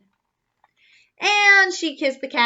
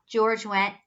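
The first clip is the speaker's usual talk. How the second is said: ordinary delivery, nothing marked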